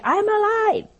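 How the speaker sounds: very high, loud, strained insistence; desperate cry. Retold or imitated. Unheard husband cries out to his wife, very high and loud. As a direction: cry out to be heard.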